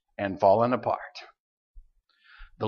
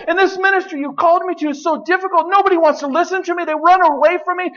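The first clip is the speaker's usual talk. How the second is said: much higher, fast self-pitying complaint; whiny Jeremiah; 12 semitones higher than usual. Acted. Jeremiah lamenting to God, in a high fast whine. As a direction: rattle off the grievances like a miserable prophet.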